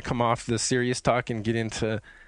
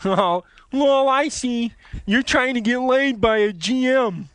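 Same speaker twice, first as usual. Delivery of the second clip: game master role; 12 semitones higher, 5 dB louder, slower than usual. Acted. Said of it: "I could be the G M".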